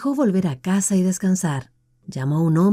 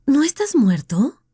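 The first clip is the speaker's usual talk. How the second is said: stunned woman; 8 semitones higher than usual. Acted. Deirdre asks the scarred man if he's alive, disbelieving. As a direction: ask as if seeing a ghost. Stunned question to returned lover.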